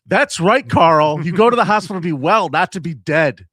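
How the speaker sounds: loud, punchy mock agreement; mock emphatic proclamation. Host echoes a news clip to his co-host, loud mock-emphatic. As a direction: belt it out like a rousing point made.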